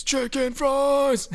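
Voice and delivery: mock screamed chant, way up high; imitated scream. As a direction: belt the song title like a wild backing singer. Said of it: "Justin screams".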